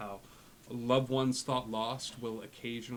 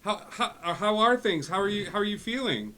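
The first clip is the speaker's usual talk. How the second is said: high, raised-voice doting dad; fussing dad; 10 semitones higher, 9 dB louder than usual. Acted. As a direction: check up on your son, high and loud. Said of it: "it's Dad".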